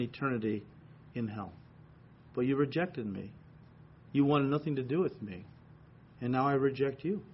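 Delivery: low, soft voice of God passing judgment; God pronouncing judgment, quiet and low; God's judgment. Acted. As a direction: speak as God rejecting the sinner, quiet and grave. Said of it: "he is gonna say to you".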